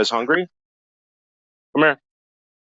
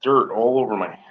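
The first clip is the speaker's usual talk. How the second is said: ordinary talk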